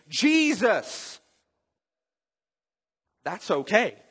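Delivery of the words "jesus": sincere calling out. Shown as a demonstration. A hurting believer calling on Jesus, earnest high plea. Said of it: "and you can say".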